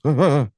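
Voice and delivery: imitated scared shout; startled yelp. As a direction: yelp like you got spooked. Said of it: "the guy freaks out".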